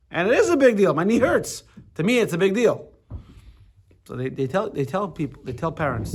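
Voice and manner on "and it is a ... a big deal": put-on high, loud child's wail of protest; whiny hurt child, louder and higher; upset child protesting. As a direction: cry out like a kid with a scraped knee. Teacher voices hurt child.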